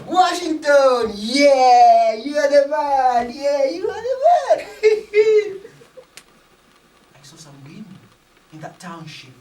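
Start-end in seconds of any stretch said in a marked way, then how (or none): 0.0-4.7 loud, higher whooping cheer; shouted cheers breaking into laughter. Retold or imitated. 4.7-6.2 high loud burst of laughter; big high laugh. Real, not acted. Laugh after cheering.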